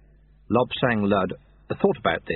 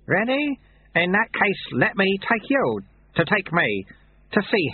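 a hint of a gentle old sweeper, higher; lightly voiced old monk; 10 semitones higher, slower than usual. A narrator's reading. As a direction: amiable old man reading a note. Lu Tse teases the novice.

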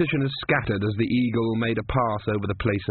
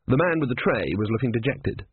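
ordinary delivery

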